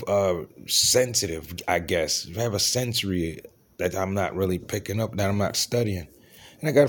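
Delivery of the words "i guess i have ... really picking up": normal talking voice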